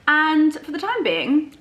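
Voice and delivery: ordinary delivery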